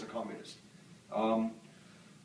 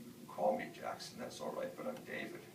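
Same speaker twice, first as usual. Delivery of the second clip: soft, half-voiced polite correction; meek reply; 7 dB quieter than usual. Confused kid answering Doctor King, meek and soft. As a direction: give in softly, like an unsure kid.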